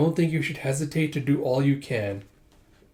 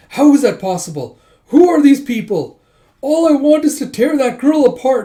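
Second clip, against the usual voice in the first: wailing villain rant, much higher and louder; overacted sobbing rage; melodramatic tantrum; 11 semitones higher, 12 dB louder than usual. Villain crying over his defeat, melodramatic wailing rant. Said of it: "He's being all melodramatic".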